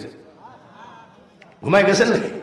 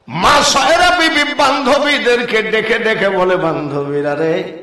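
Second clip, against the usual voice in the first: melodic sermon storytelling; 3 semitones higher, 5 dB louder, slower than usual. A narrator's reading. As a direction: chant the story with long drawn-out phrase endings.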